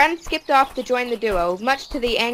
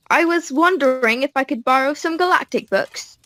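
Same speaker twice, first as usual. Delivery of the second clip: put-on polite English accent, pitched up; 5 semitones higher than usual. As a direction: ask it in a light English accent. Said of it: "classic English accent".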